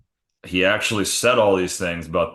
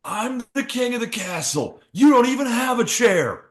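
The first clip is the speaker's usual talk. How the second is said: put-on childish taunt. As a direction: crow like a kid on top. Taunting from jet ski.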